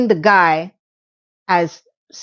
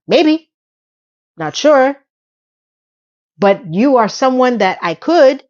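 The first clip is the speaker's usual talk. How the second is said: ordinary delivery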